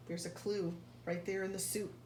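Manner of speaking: ordinary delivery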